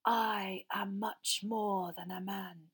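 very slow, cold villain. A narrator's reading. Master boasting to Frank, slow cold voice. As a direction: draw it out with cold menace. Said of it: "said the cold voice".

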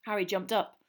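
ordinary delivery

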